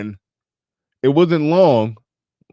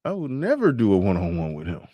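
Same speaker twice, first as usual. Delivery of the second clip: ordinary talk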